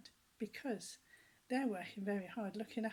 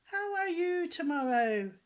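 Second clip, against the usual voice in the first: high, louder clownish question; Humpty's question; 8 semitones higher, 9 dB louder than usual. Retold or imitated. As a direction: ask it like a silly pantomime egg. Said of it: "And he said".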